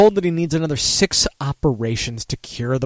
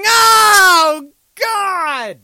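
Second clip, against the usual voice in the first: disgusted cry; 7 dB louder than usual. Real, not acted. Disgusted at man drinking river beer.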